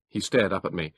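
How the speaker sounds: plain reading voice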